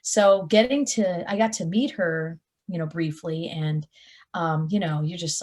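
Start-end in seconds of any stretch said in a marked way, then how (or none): none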